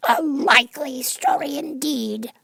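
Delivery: very high, slow, scornful bird voice; high slow scornful pigeon; contemptuous. A narrator's reading. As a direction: pigeon sneers at Alice. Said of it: "in a tone of deepest contempt".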